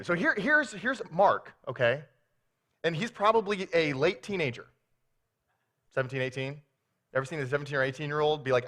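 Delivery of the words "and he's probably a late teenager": ordinary talk, nothing marked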